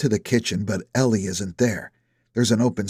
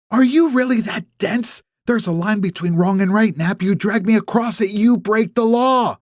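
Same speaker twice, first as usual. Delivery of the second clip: Ellie voiced higher, indignant and pressing; angry accusation; 10 semitones higher, 3 dB louder than usual. A narrator's reading. Ellie confronts Nap in the driveway, higher, indignant and pressing. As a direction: push him hard on the line he crossed.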